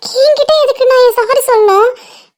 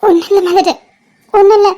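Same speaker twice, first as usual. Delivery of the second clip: usual speaking voice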